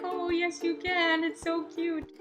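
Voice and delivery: high, soft gushing; gushing aww. Retold or imitated. Vlogger recalling her reaction to the lost boys, soft high coo. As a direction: coo over the cute boys. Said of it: "I was like".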